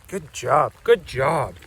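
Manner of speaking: ordinary talk, nothing marked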